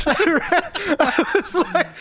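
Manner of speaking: high, loud, breaking into laughter; laughing. Real, not acted. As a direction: laugh through the words. Cracking up over the comment.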